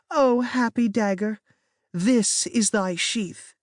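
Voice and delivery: slow, overblown Shakespeare recital; melodramatic. A narrator's reading. Katie acting Juliet's death for her sibling, melodramatic. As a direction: ham up the death line. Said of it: "very melodramatically".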